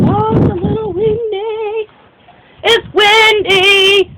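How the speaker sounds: high, slow voice, stretched out; drawn-out high remark; high slow aside. Real, not acted. To the viewer about the wind, a high slow aside. Distracted by wind mid-sentence.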